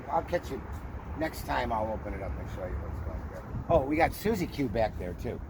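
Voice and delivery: normal talking voice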